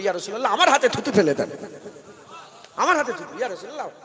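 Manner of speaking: loud, pleading cry of eager companions; eager begging. Acted. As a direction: call out like companions pushing forward. Companions begging for the Prophet's spit. (সাহাবাদের উচ্চস্বরে আকুল মিনতি)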